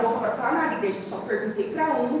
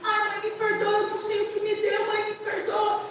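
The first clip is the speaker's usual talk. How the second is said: much higher, pleading cry; sobbing plea; 9 semitones higher than usual. Retold or imitated. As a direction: beg forgiveness through tears. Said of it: "E chorou, chorou".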